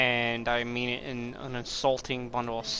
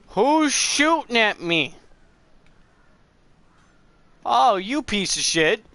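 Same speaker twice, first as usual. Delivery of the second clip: much higher, louder startled yell; startled anger; 9 semitones higher, 8 dB louder, slower than usual. Real, not acted. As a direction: yell at the unseen archer. Shot from nowhere in game.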